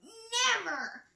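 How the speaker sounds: high-pitched, drawn-out refusal; Vulture crying out. A narrator's reading. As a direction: cry it out high, stretching the word. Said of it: "cried Vulture".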